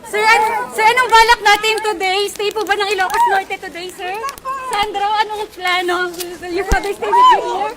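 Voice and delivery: high, loud call across a crowd. Real, not acted. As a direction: shout questions over the crowd. Reporter calling out to candidate.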